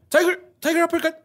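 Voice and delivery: high shouted move call; fighting game shout. Retold or imitated. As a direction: yell it like Sagat in the game. Imitating Sagat move call.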